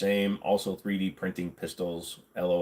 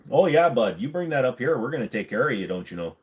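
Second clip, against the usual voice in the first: put-on Canadian accent; 3 semitones higher than usual. Canadian accent bit, "bud", "don't you know.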